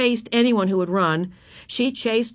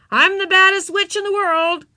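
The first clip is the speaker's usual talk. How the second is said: high, loud little-witch yell; boastful child witch holler; 11 semitones higher, 5 dB louder than usual. A narrator's reading. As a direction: yell it proudly across a playground. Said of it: "yelled Ramona".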